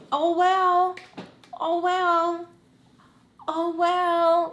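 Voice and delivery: high, loud sing-song shrug; bratty indifference. Retold or imitated. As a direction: taunt her, you don't care. Bratty reply to crying mom.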